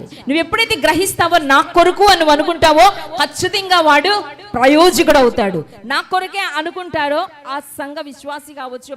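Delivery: loud, rising sermon voice pressing each point; full-force preaching. Acted. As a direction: preach it out, urging the flock to take hold. Preaching to the congregation. (గట్టిగా ప్రసంగించే స్వరం)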